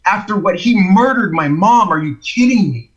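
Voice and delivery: retold angry rant; indignant disbelief. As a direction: protest as if outraged by the very request. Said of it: "it made me angry on the inside".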